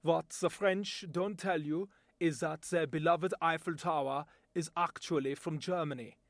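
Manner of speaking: English with a German accent; a proud German mocking the French. Shown as a demonstration. A German talking beer and football, in a German accent. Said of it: "my voice talent demonstration".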